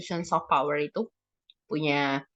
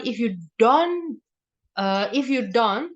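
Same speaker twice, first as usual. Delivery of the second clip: slow, drawn-out warning; mock threatening; 3 semitones higher, slower than usual. Punisher warning a child, acted slow threat. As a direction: set up a threat slowly. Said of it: "terdengarnya mengancam".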